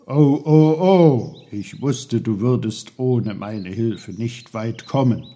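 loud exclaiming, then self-satisfied uncle; Onkel Pfefferkorn. A narrator's reading. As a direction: burst in loudly, then settle into knowing chiding. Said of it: "kam die Stimme Onkel Pfefferkorns".

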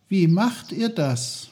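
put-on high-pitched, eager child's question; curious boy. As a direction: ask wide-eyed in a high boy's voice. Peter eagerly asks the trees.

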